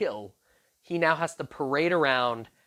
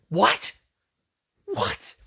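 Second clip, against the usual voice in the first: high startled what, second one breathless; choking shock; 6 semitones higher, 3 dB louder than usual. Retold or imitated. Haman choking as Esther names him, stunned. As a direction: gasp it out as the truth hits.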